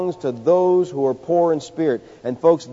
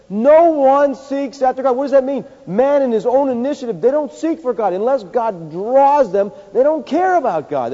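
full-force preaching; 8 semitones higher, 4 dB louder than usual. Acted. Preacher thunders at the congregation, loud and high. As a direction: hammer each phrase home from the pulpit.

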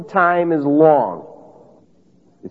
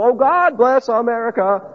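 higher, hammed-up sudden piety; mock pious plea; 6 semitones higher than usual. Preacher mocking a country remembering God only in crises, mock piety. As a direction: say it like a panicked afterthought prayer.

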